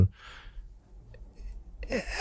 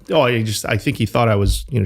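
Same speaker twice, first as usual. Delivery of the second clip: ordinary talk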